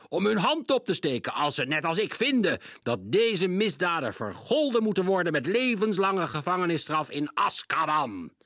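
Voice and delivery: loud, high stern demand; shouting judge. A narrator's reading. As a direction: demand the life sentence. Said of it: "schreeuwde meneer Krenk". (luid eisend vonnis)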